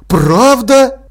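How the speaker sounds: high, loud eager question; delighted amazement. A narrator's reading. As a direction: ask it thrilled and amazed. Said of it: "восхищённо воскликнул Тепик".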